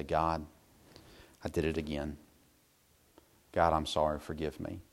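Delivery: very soft, low prayer; hushed, sorry plea; contrite confession. Retold or imitated. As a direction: admit it humbly, almost under the voice. Acted repeated confession to God.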